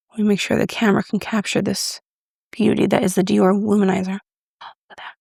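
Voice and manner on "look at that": unvoiced, breathed; hushed admiration; awed whisper. Real, not acted. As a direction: whisper in wonder. Awed over luminizer.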